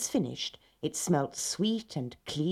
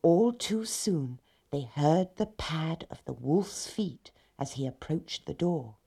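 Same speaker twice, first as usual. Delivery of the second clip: usual speaking voice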